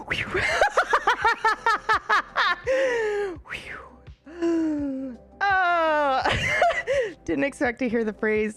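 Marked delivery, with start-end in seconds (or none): 0.1-6.2 high, breathless laughing fit; real high-pitched cackling fit; helpless laughter. Real, not acted. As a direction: laugh hard, whooping for air between bursts.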